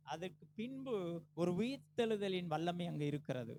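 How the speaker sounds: ordinary delivery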